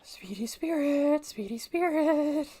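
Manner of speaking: high, loud, long-drawn call; sing-song calling. Pretend. Player summoning Speedy Spirit in the game, sing-song calling. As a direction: stretch it out like a ghostly summons.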